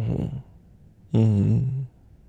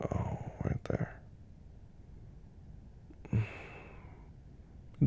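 whispering; 5 dB quieter than usual. Acted. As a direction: hush it close to her ear. Whispered comfort throughout.

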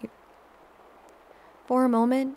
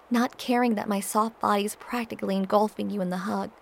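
ordinary delivery

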